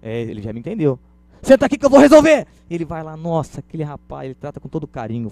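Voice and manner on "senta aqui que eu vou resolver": high, loud mock-indignant command; hammed-up forceful order; taking charge loudly. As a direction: shout it as a salesman outraged on the customer's behalf. Salesperson mirrors angry customer, takes charge.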